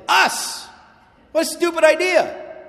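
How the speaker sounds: loud exclamation. Real, not acted. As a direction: throw it at them in outrage. Preacher exclaims at congregation.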